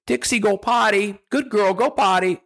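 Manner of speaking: high, sing-song potty command; cheerful command to puppy. Shown as a demonstration. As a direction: coax the puppy sweetly to go. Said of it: "you say".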